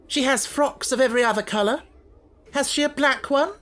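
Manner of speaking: high, loud and sharp; impatient pressing. Acted. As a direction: press her hard. Angry headmistress presses.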